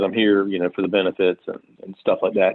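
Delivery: low-pitched, lightly imitated cocky guy. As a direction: retell it low, with a hint of swagger. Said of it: "he's just trying to act all cocky".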